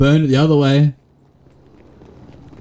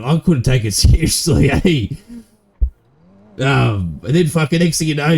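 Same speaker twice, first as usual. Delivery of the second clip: ordinary talk, nothing marked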